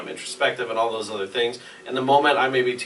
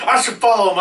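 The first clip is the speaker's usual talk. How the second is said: fiancee; 11 semitones higher, 8 dB louder than usual. Retold or imitated. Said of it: "She's like".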